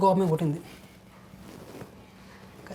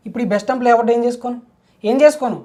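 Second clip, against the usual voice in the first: loud, higher-pitched exasperated question; frustrated outburst; 4 semitones higher, 6 dB louder, faster than usual. Acted. Man lamenting that his possessions are no use, frustrated. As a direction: throw the question out louder, building higher.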